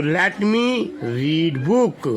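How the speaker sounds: very slow, every word drawn out; slow model reading. Shown as a demonstration. Teacher models English sentence for first-class children. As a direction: say it slowly so children can copy. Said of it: "teacher models for children".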